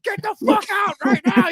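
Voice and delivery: high, loud mock shouting; hammed-up furious order; irate bartender. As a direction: yell like a bartender throwing someone out. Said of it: "the bartender's like".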